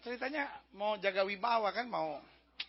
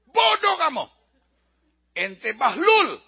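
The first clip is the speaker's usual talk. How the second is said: loud mock-angry rebuke; hammed-up exasperated scolding; scolding general; 5 semitones higher, 10 dB louder, slower than usual. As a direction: berate the troops with drawn-out insults. Acted general scolds his soldiers.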